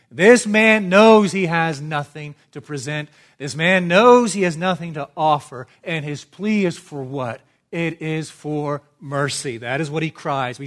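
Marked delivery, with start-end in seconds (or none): 0.1-5.7 loud, insistent pulpit delivery; full-force preaching. Acted. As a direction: drive the repeated point home loudly.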